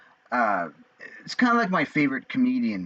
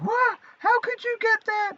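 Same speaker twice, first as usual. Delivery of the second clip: high falsetto mock mom; shocked mom. Mom spotting the rude shirt, high mock shriek. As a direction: squeal it like a scandalised mother.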